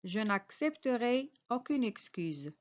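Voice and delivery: ordinary talk, nothing marked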